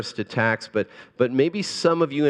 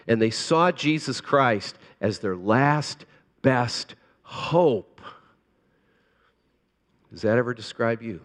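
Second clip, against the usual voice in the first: ordinary talk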